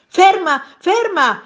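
high, loud yell to stop; urgent shout. A narrator's reading. Man on balcony to his fleeing nose, urgent shout. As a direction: call after a runaway, high and loud. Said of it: "gridò il signore".

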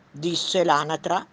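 ordinary delivery, nothing marked

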